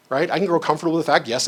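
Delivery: ordinary delivery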